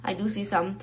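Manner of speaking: muttered afterthought, nearly swallowed; quiet aside. Real, not acted. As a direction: drop the voice to say "I do see some" under your breath.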